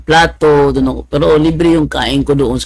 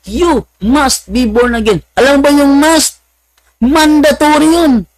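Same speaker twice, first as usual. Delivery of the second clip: mock preacher; 12 semitones higher, 3 dB louder than usual. Mock preaching about must.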